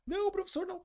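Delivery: put-on high, pleading boy's voice; a scared kid begging off; mimicking a scared swimmer refusing his coach; frightened plea. Scared boy refusing, quoted.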